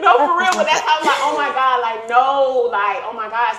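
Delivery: high, loud retold oh my God; high loud retelling of panic; excited panic. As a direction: relive the panic loudly. Retold panic, still laughing.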